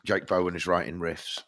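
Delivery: ordinary talk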